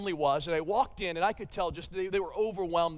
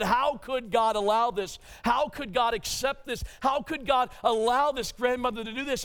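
retold anger at God; 6 semitones higher, 5 dB louder than usual. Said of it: "There's a bit of angst in me that's angry toward God".

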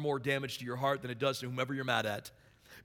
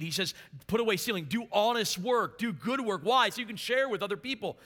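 raised, higher-pitched sermon delivery; fervent preaching; 7 semitones higher, 5 dB louder than usual. Real, not acted. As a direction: preach the verses with driving, emphatic force. Preaches at full force from the verses.

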